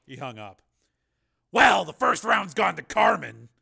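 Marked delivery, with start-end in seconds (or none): 1.5-3.5 loud, slow, raised grim verdict. A narrator's reading. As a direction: concede the round with grim force. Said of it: "he said grimly".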